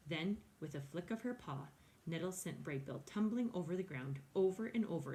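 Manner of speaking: plain reading voice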